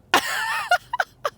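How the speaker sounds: high-pitched mocking laugh; imitated laugh. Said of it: "he starts laughing".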